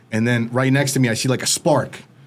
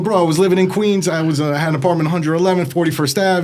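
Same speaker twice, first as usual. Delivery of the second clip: excited; 6 semitones higher, faster than usual. Retold or imitated. Excited to meet someone from Queens.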